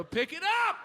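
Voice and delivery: cartoonish high-pitched jeer at the customer; high mocking order. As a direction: bark it high and shrill like a taunting cashier. Worker barking order, high shifted voice.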